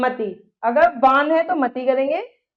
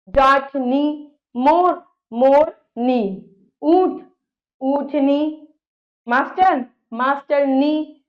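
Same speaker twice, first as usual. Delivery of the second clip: slow teaching demo; slower than usual. Shown as a demonstration. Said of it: "कैसे देखिए".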